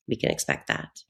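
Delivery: breathy whispered aside. Acted. Aside after listing grim predictions.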